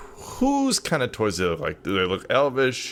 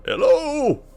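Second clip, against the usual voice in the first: high, louder drawn-out call; character yelling out; 5 dB louder than usual. Acted. Hodgins calling into the abandoned room, high yelled hello. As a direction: call out into an empty house. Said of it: "Hodgins is gonna kinda yell out".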